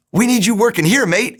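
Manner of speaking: lightly voiced Australian executive, cheerful; Australian accent. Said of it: "he's an Australian guy".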